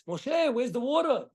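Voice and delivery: high, pushy crowd demanding water; agitated crowd. Retold or imitated. People pressing Moshe for water, high and demanding. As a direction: nag him like an impatient mob.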